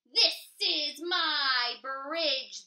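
loud, slow troll snarl. Acted. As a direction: growl it like a territorial troll. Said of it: "the troll snarled".

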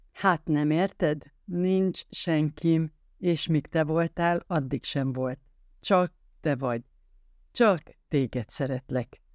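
slow, emphatic declaration, word by word; every word stressed. A narrator's reading. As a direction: weigh each word of "Csak téged szeretlek", spacing them out. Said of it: "hangsúlyozott minden egyes szót". (minden egyes szót lassan hangsúlyozva)

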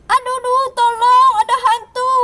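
high, loud cry for help; mock panicked cry. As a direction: yell for rescue from a ghost.

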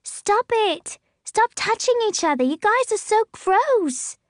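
put-on high, loud, disgusted kid yelling; a little girl shrieking at her parents; girl crying out. A narrator's reading. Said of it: "Emmeline cries".

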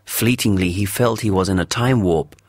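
ordinary delivery, nothing marked

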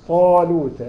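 "طالوت" with a heavy emphatic ط, mouth closed, said loud. Shown as a demonstration. Teacher drilling students on a heavy ط, loud and firm. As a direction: stress the heavy ط in "طالوت" firmly. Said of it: "لا، لازم تطبق الفم".

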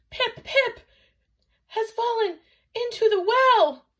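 very high, loud, broken-up cry; gasped. A narrator's reading. Chang out of breath finishing the news, gasping. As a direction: gasp it out in pieces, squealing high. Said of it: "he gasped".

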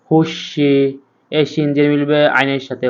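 ordinary delivery

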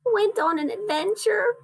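put-on high little baby-animal voice; shivering Baby Bear. A narrator's reading. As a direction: pitch it up like a small cold cub. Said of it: "said Baby Bear, shivering".

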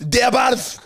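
high, loud mock Scottish shout; imitating the Scottish nurse, shouting; Scottish accent. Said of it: "most thick Scottish fucking accent".

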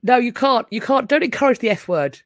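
loud mock protest; mock pleading; playful protest. Real, not acted. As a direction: beg them to stop, half laughing. Pleading with chat.

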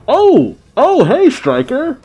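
high, loud exclamation of recognition; surprised greeting. Real, not acted. Viewer spots Stryker on screen, high surprised greeting. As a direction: greet him in startled high pitch.

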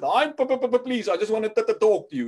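high, loud stuttering plea. Retold or imitated. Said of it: "a little stutter".

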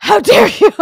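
high, loud indignant cry; outraged shout. Retold or imitated. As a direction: shriek it in mock offense. Acted outrage in retold party.

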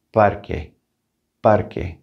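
"parque" said PAR-keh with a hard K; "parque" with QU as a plain K, no W; Spanish parque. Shown as a demonstration. Teacher models "parque" for learners, QU as K. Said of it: "that's gonna have, like, a K sound".

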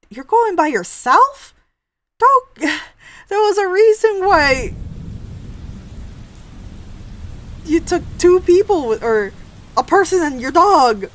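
high, loud disbelieving outburst; exasperated protest. Real, not acted. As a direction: scold the character in loud disbelief. Reader protests at the character's choice.

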